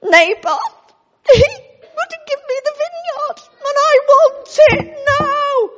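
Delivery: put-on high, loud sulky wail of a spoilt child; whiny sulking king. Sulking king wailing to his wife. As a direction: whine it like a toddler who wants a toy now. Ahab whining to Jezebel.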